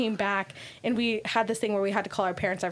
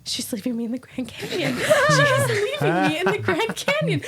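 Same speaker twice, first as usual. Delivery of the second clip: laughing through a high, louder panicked wail; mock panic, laughing; 10 semitones higher, 5 dB louder than usual. Friend alone in the tent thinks she is abandoned, laughing mock panic. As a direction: play her panic but crack up.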